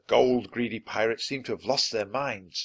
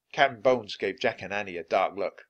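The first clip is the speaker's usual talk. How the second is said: ordinary delivery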